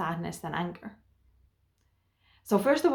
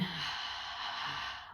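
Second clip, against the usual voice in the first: slow breathy exhale, letting go; a grounding breath out, audible; long audible sigh; 10 dB quieter than usual. Shown as a demonstration. Coach shows the listener a calming breath, long sigh. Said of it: "Like I'm noticing my breathing right now".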